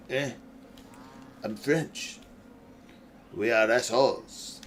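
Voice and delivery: dismissive Frenchman impression, French accent; hammed-up French accent with weary pauses; mock French accent. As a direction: imitate a snooty French smoker's accent. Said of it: "A French guy wouldn't give me".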